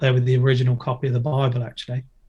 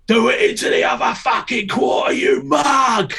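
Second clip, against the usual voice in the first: high furious yell across the skatepark; retold angry shout; 10 semitones higher than usual. Man yelling at skater to go bigger, furious shout. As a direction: bark it like a coach losing patience. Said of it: "he started shouting".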